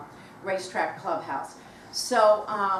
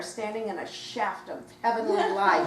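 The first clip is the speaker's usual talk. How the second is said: ordinary talk, nothing marked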